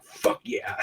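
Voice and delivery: mock princess whispering as she leaves; whispered taunt. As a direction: hiss it gleefully under breath.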